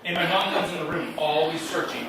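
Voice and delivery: ordinary delivery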